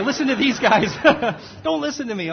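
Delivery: cracking up at his own mistake; laughing at himself. Real, not acted.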